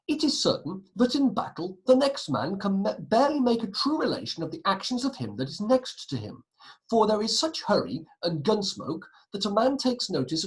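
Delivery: dramatic source reading. Acted. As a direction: read it out like a rousing first-hand account. Reads historical source aloud dramatically.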